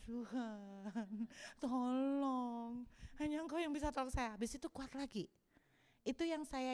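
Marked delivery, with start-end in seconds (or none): 0.0-4.4 imitated sobbing prayer; tearful whimper. Preacher crying to God at his lowest, whimpering. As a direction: whimper the plea, starting soft and drawn out.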